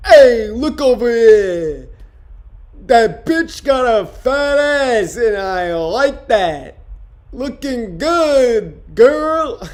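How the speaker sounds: slow, high, loud sleazy catcall voice; Andrew Nice Clay impression. Comic doing his Andrew Nice Clay bit for the stream, slow loud catcall. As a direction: drag out a leering pickup line. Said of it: "This is my impression of Andrew Nice Clay".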